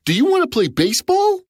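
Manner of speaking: high cheerful giant voice; friendly giant. Acted. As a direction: invite him to play.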